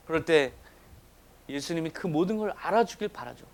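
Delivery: ordinary delivery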